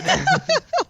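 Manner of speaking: very high burst of laughter; loud shrieking laugh. Real, not acted. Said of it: "that loud laugh that just echoed".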